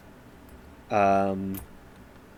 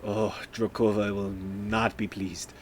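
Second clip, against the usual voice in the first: vampire talking to himself; 2 semitones lower, 5 dB quieter, slower than usual. Acted. Vampire to himself after missing, softly dismayed aside. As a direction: say it under your voice, to yourself. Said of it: "he'll probably say to himself".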